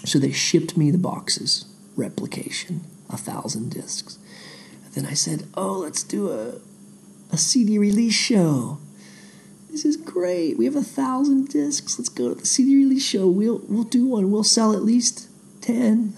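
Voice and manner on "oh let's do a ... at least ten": hammed-up giddy optimism; mock excitement. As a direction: imitate your own naive enthusiasm, pitched way up. Acts out his own eager plan.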